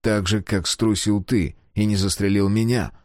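ordinary delivery

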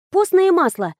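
ordinary delivery

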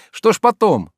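Oblomov, pressing. A narrator's reading. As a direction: push her for the rest. (Обломов торопит)

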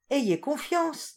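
high and slow, soothing; calm the frightened birds gently; a queen soothing her subjects, slow and high; reassuring queen. A narrator's reading.

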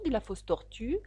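plain reading voice

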